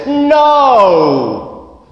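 a high, drawn-out, fed-up no; the barmaid's patience snapping; exasperated no. Acted.

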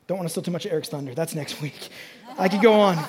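ordinary delivery

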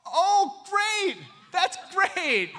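loud, high-pitched mock alarm; comic panic. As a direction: fret at top pitch, playing it for laughs. Acts out his panic at parents coming.

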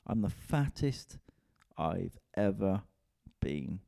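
drawn out slowly, a word at a time; slow, spaced repeat. Real, not acted. Comedian repeating his weight confession to listeners, slow and drawn out. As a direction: let each word land slowly and heavily.

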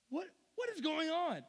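high-pitched baffled outcry; bewildered exclamation. Acted. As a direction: cry out in mock bewilderment. Acting out bewildered missionaries.

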